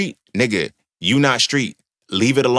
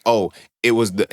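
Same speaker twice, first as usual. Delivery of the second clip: ordinary talk, nothing marked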